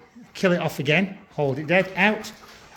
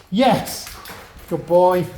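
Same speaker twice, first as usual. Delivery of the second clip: excited praise; 4 semitones higher, much slower than usual. Real, not acted. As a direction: cheer him on. Praising the dog.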